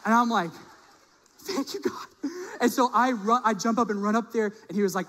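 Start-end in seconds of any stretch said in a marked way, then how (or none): 1.4-2.3 high, soft, grateful sigh of thanks; relieved thanks. Retold or imitated. Pastor thanking God as his phone comes back, soft and high. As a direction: breathe out real relief.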